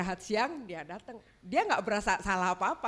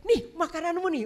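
higher, louder serving voice; mother-in-law voice; 7 semitones higher, 5 dB louder than usual. Retold or imitated. Mother-in-law serving her son food, higher and louder. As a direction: push the plate at him fondly.

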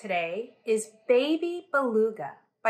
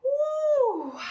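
excited; 14 semitones higher than usual. Real, not acted. Cheer after song.

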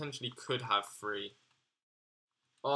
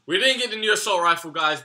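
excited burst; 6 semitones higher, 11 dB louder, faster than usual. Real, not acted. Excited recap to viewers.

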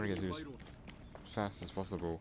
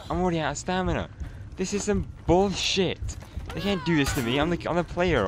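pissed-off rant; 7 semitones higher than usual. Real, not acted. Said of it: "I'm pissed off now".

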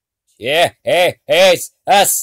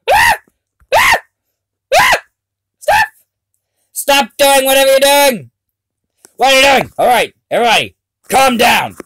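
frantic yelling; berserk rage; 9 semitones higher, 3 dB louder than usual. Real, not acted. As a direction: shout in panic and rage. Yells at game.

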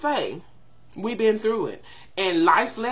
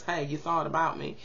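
ordinary talk

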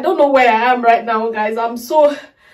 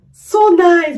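higher, louder so nice; gushing; 7 semitones higher, 5 dB louder than usual. Real, not acted. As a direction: burst out with delight. Repeat with more emphasis.

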